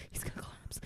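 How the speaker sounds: unvoiced whispered worry. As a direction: whisper it like a worried girl in the back. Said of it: "one of the girls like".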